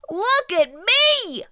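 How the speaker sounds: high, loud, slow proud boast; cried out. Acted. Splat showing off his sock spider, high and proud. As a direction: crow proudly about the sock legs. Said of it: "he cried".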